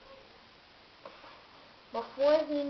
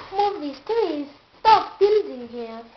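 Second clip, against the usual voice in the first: loud, slow furious shout; purple rage; 5 semitones higher, 12 dB louder, slower than usual. A narrator's reading. Patrick in a furious rage at the builders. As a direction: rage at them to stop. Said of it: "in a purple rage".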